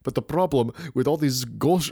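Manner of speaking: ordinary talk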